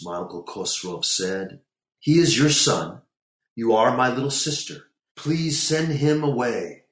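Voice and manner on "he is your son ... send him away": raised, higher pleading; exasperated plea. A narrator's reading. Uncle begging his sister to remove her son, exasperated.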